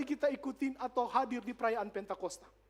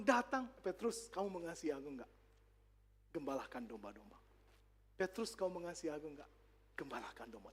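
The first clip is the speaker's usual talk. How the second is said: gentle Jesus; 10 semitones lower, 11 dB quieter than usual. Retold or imitated. Jesus asks Peter gently.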